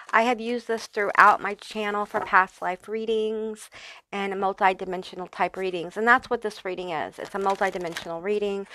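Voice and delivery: ordinary delivery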